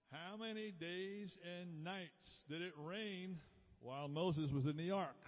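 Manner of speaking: drawn-out quizmaster delivery; slow question reading. Real, not acted. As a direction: read the question out slowly, word by word. Quiz question read out, first time.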